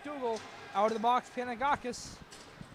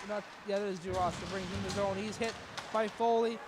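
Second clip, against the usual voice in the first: ordinary talk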